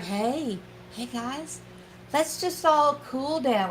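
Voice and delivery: a light mock-calm referee voice; calming plea. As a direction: settle everyone down like a tired peacemaker. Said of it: "has just had enough of the whole thing".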